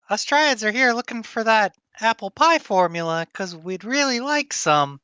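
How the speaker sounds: light, airy forest spirit; female dryad voice. Acted. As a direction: voice her as a gentle dryad. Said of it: "in my female dryad voice".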